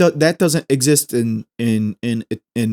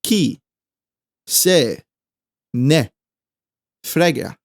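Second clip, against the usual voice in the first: each word slowly apart; slower than usual. Shown as a demonstration. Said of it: "In Italian".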